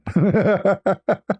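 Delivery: a high, helpless burst of laughter. Real, not acted. Laughing at the stunned man in the elevator, high helpless laugh. Laughing at his own story.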